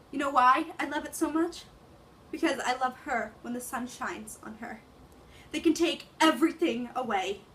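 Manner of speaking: rising to a passionate cry; dramatic monologue. Acted. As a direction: build from grief to a desperate shout. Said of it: "I can act out the English version".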